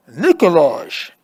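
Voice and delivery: a muffled shout from far off. A narrator's reading. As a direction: call out through a ceiling, muffled. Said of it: "Anna shouts in a muffled voice".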